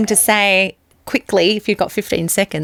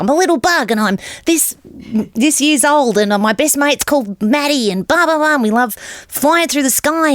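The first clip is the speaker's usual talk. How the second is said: higher-pitched bug introducing itself; little bug character; 8 semitones higher than usual. Acted. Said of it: "talk about yourself".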